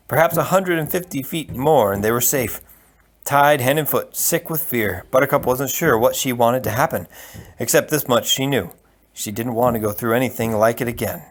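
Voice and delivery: plain reading voice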